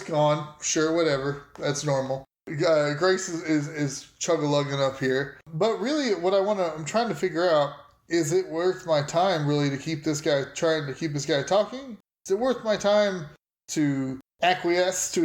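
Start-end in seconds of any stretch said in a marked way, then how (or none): none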